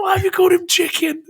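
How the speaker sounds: mock outrage, half laughing; incredulous shout. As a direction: shout the disbelief through laughter. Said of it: "I'm like".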